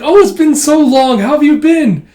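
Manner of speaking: high, loud gush of delight; giddy greeting. Retold or imitated. Old friends meeting after so long, giddy.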